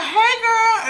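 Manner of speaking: high, squealed hey to a friend; high-pitched greeting. Retold or imitated. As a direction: sing out a mock high hello. Acting out Keisha's greeting.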